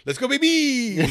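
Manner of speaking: high, loud hype shout with a laugh; excited cheer. Retold or imitated. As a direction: pump it up like a hype man. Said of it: "Or I go".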